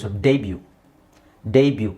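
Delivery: ಡೆಬ್ಯೂ with a long DAY at the start; teacher's model ಡೆಬ್ಯೂ, first syllable held; drawn-out first syllable. Shown as a demonstration. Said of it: "ಡೇ ಅಂತ ಎಳಿಬೇಕು".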